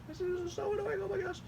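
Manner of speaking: very high, squeaky near-crying whine; exasperated squeal. Real, not acted. Host fed up with campaign texts, squealing. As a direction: squeak it out, worn down by annoyance.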